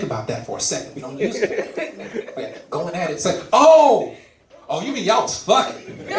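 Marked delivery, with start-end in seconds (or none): 3.5-6.1 dawning realization. Acted. Listener decoding the slang, mock sudden realization. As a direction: suddenly catch on to what was meant.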